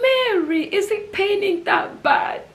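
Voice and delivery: high, tearful acted cry of concern; distressed sister. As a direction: imitate a sister wailing with worry, pitched high. Sister voiced reacting to her collapse.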